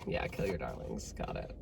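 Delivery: low, soft, dry resignation; a touch of grim irony; deadpan sarcasm. Retold or imitated. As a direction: mutter it dryly under your breath. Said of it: "I was like".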